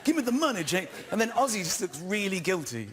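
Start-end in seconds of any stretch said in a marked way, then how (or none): 0.0-0.9 kid's mock American gangster, pitched higher; hammed-up American movie gangster demanding cash; fake American gangster accent. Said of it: "doing this pseudo-American accent".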